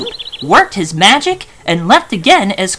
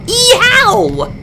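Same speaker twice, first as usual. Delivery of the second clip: high yowling cry; fox yelp of pain; 11 semitones higher than usual. A narrator's reading. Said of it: "terrifying flurry".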